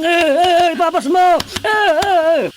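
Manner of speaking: high, loud strained cries; loud anguished outburst; anguished crying out. Acted. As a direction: wail as the pain breaks loose. Exorcism-like climax, crying out.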